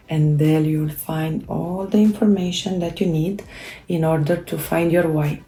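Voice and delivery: ordinary delivery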